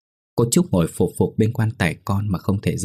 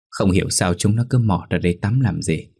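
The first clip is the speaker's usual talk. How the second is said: usual speaking voice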